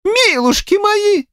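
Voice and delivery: high, drawn-out village woman's lament; shocked gasp. A narrator's reading. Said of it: "ахнула одна из баб". (ахает по-бабьи, высоко и протяжно)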